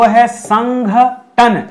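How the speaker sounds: संगठन said very slowly with a clear ठ; drawn-out संगठन; slow correct form. Shown as a demonstration. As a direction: lay out संगठन slowly, sound by sound. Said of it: "जो शुद्ध शब्द है वो".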